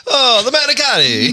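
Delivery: put-on Italian accent. Joining the Italian bit.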